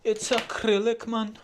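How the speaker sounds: slipping into a high Newcastle Geordie accent; accidental Geordie accent. Real, not acted. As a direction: Geordie accent popping out by itself. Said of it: "why you doing a Newcastle accent".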